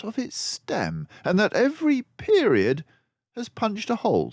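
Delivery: high, loud, brisk detective voice; a clever mouse sleuth, pleased with himself; Basil the mouse detective. A narrator's reading. Narrator voicing Basil to Dawson, high and loud.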